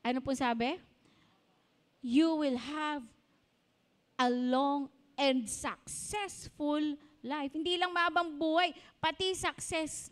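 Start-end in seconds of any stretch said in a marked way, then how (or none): 2.0-7.5 slow recitation. Shown as a demonstration. Reciting the promise slowly.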